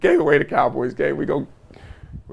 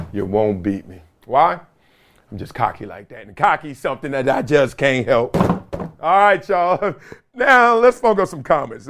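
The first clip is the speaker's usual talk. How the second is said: normal talking voice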